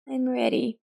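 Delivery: put-on small, bitter girl's voice. A narrator's reading. Girl tells her father and Elsa, bitterly. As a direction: say it bitterly, resigned. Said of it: "with bitterness in her voice".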